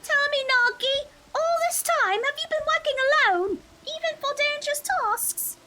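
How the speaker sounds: high, slow and gentle elder sister; sister character voice. Acted.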